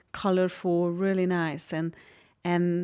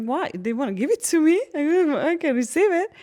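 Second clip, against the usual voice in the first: put-on high, faster, louder indignant kid whining back; a hint of a wronged child insisting she may take it; childish protest; 9 semitones higher, 5 dB louder than usual.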